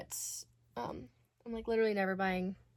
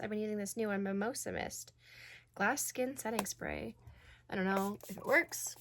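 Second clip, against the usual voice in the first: normal talking voice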